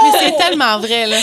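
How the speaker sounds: words through loud laughter; laughing while talking. Real, not acted. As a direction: still cracking up. Laughing at the fridge joke.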